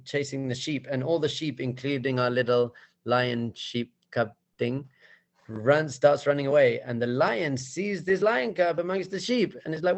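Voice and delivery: usual speaking voice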